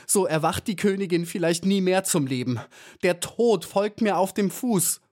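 high, passionate outcry at moderate volume; passionate cry. A narrator's reading. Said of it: "Da schrie Maya so laut und leidenschaftlich". (leidenschaftlicher, hoher Aufschrei)

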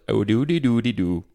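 hint of a sing-song Indian lilt on nonsense syllables; mock an accent drifting Indian; lightly imitated Indian accent; sliding Indian accent. Shown as a demonstration. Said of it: "all of a sudden it goes".

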